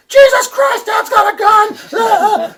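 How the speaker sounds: high, loud panicked scream, slow; imitating daughters screaming in alarm; screaming girls. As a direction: shriek it like a terrified daughter. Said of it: "the girls are screaming".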